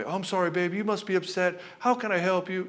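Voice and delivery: apologetic and weak. Shown as a demonstration. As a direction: grovel to the upset wife. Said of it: "We're not doing it in an apologetic way".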